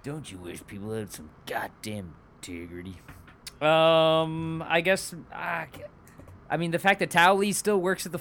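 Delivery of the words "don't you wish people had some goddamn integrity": low, soft Southern ad voice; lightly mimicked folksy commercial. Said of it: "do these dumb voices".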